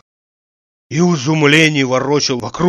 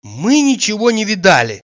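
women in unison; 6 semitones higher than usual. A narrator's reading. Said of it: "сказали обе женщины в один голос".

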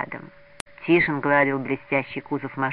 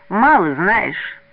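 slow, drawn-out taunt; louder, higher, smirking drawl; mocking sneer; 4 semitones higher, 6 dB louder, much slower than usual. A narrator's reading. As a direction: toss it out with a smirk. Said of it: "усмехнулся Кудрявцев".

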